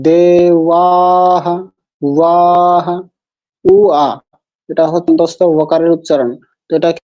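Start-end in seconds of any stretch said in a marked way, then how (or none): 0.1-3.0 দে and ওয়াহা drawn out, vowels held; long syllables. Shown as a demonstration. Teacher modelling long vowels, দে, ওয়াহা slowly sustained. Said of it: "দে দীর্ঘ হবে". 3.6-4.1 উ and আ as two distinct sounds; separated vowels. Shown as a demonstration. Teacher splitting the sound to explain it, উ and আ apart. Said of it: "এমন না যে পৃথক পৃথক".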